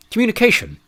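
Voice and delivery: incredulous scoff, pitched high. A narrator's reading. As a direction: scoff at the word in disbelief. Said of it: "scoffed Master Fowl".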